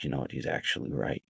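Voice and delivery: low, hushed admission to himself; softly conceding; quiet realization. Retold or imitated. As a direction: retell a private concession, soft and low. Quietly concedes the old man's point.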